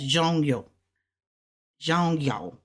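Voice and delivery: "zhong, yo" run together quickly; said fast. Shown as a demonstration. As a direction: blend "zhong, yo" fast into one word. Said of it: "So we're gonna say it fast".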